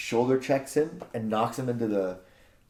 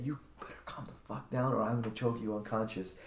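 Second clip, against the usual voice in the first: retold threat; 11 dB quieter than usual. Homeowner warning the plumber he has in a choke, hushed threat.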